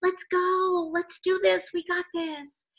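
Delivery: rally them like a cheerleader. Acted. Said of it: "It's a cheerleader".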